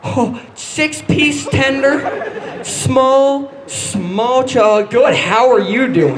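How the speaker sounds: slow, stumbling, laughing order; flustered customer. Retold or imitated. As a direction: fumble the words like a nervous kid. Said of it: "from her point of view".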